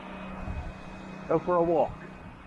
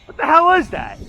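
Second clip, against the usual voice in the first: high, loud, baffled cry; a man staring at a strange sculpture; gawking statue guy; 9 semitones higher, 7 dB louder than usual. Retold or imitated. Statue figure to his friend, high loud baffled cry. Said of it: "and they're going".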